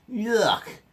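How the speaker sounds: high, squealed yuck; disgusted sister. A narrator's reading. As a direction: spit out the word in disgust. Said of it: "said sister".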